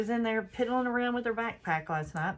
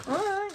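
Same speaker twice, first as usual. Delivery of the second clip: put-on child whine; 12 semitones higher, 5 dB louder than usual. Child whining.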